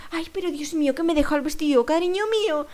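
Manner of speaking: mild lament, lifting at the end; acted fretting. Older woman to a stranger about her forgotten dress, mild lament. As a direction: wail lightly, without shouting.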